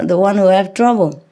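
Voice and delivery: ordinary delivery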